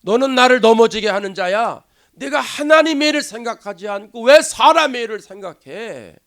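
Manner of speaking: loud, forceful scolding as Jesus; stern rebuke. Acted. Jesus rebuking Peter as Satan, stern and forceful. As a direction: rebuke sharply, driving the key words hard. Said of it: "예수님이 그냥 사탄아 그럽니다".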